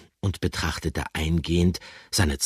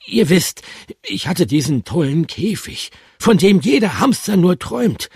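lispelnd; 11 semitones higher, 7 dB louder than usual. Acted. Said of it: "protestierte Bertram lispelnd".

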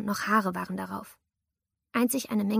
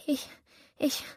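stammering; 5 semitones higher, 4 dB quieter than usual. Acted. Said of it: "stammelte ich".

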